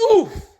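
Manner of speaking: loud high winded oof; oof of impact. Acted. Blinky and the farmer grunt as the door flies open. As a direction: grunt as you get knocked. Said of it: "said both Blinky and the farmer".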